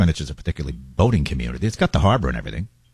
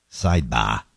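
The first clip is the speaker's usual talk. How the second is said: put-on Boston accent; 8 semitones lower, slower than usual. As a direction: lower voice, say "sidebah" Boston style. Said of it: "in his Boston accent".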